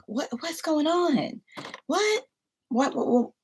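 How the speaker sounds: piling up bewildered questions; shocked disbelief. Retold or imitated. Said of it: "and I'm like".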